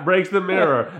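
usual speaking voice

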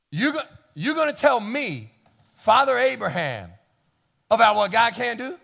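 rising, loud incredulous retort; indignant old Abraham. Retold or imitated. Old Abraham scolding the servant, building to loud disbelief. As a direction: scoff at being lectured. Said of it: "and he says".

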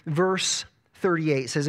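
normal talking voice

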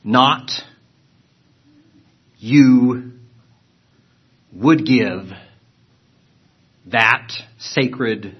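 word by word, slow and halting; slow literal reading. Shown as a demonstration. Teacher reading a literal Greek rendering to students, slow and halting. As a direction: read each word apart with long pauses. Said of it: "I'll try to read it slowly".

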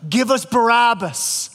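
slow, drawn-out chant of an angry mob; mob crying out. Retold or imitated. Preacher voicing the crowd demanding Barabbas, slow mock mob cry. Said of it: "had cried out like a mob".

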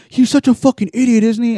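high-pitched imitation of a griping drunk; mock outrage, pitched well up; retold ranting friend. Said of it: "he's, like, out there reaming me out".

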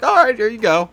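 sarcastic seller handing out a gun with no questions; mock gun seller. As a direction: hand it over like it's nothing. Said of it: "And they're like".

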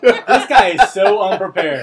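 slow, laughing through the words. Real, not acted. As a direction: laugh while you mock him. Host laughing at the guest.

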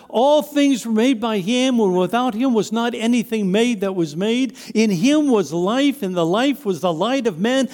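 preacher declaiming a sacred text; scripture recitation. Acted. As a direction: proclaim each verse with steady, rising force. Recites scripture from John's Gospel.

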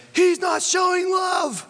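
high, loud, drawn-out scream; little kid screaming in a fight. Retold or imitated. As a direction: scream it at your brother, full voice. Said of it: "one of us screamed at the other".

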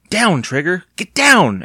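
high, urgent command to a dog; crying out. A narrator's reading. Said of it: "Evan cried".

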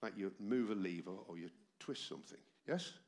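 ordinary talk, nothing marked

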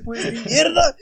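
loud, high mock outburst; abuelo furioso. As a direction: blurt it like a granddad losing it. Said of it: "el abuelito coge el modem y le dice". (arrebato fuerte y agudo, imitado)

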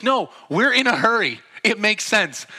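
high, loud argumentative comeback; mock-heated self-justifying retort; stubborn insistence. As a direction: insist hotly as the impatient driver. Acted argument, driver insists.

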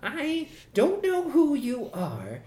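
a boss pinning the blame. Acted. Boss blaming Maxwell, high and suspicious.

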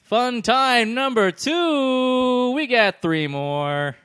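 booming slow announcer, high and loud; fighting game announcer. Acted. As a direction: call it like an arena announcer. Announcer voice again.

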